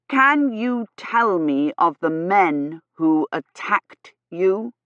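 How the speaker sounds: "Can you tell me of the men who attacked you" slow, word by word; every word emphasized. A narrator's reading. Gawyn questioning the lady about attackers, every word over-distinct. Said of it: "emphasizing every word".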